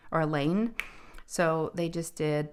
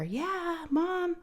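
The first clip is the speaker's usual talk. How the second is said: mock child reply; 12 semitones higher than usual. Daughter answers from afar.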